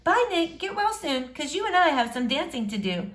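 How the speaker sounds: put-on shy girl greeting a boy she likes.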